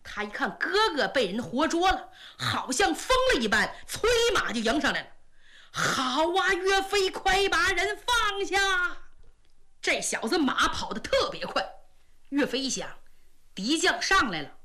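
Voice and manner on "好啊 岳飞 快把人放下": higher, slow charging shout; angry demand. A narrator's reading. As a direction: shout it while galloping in.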